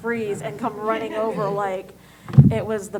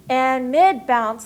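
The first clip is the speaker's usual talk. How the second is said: ordinary talk, nothing marked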